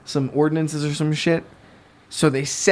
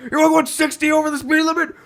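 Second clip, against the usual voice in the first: high, fast mocking driver voice with laughter; mocking an American driver raging on the turnpike; mock road rage; 13 semitones higher than usual. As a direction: lampoon an unhinged driver, pitched way up.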